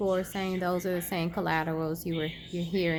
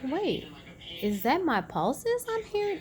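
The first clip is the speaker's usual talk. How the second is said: surprised question; 7 semitones higher than usual. Retold or imitated. Patient hearing her own pulse, startled and high. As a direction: pitch jumps way up in disbelief. Excited discovery at the Doppler.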